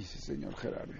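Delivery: breathy whisper, pleading; whispered plea. Real, not acted. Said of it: "pleading to Gerardo". (susurro suplicante)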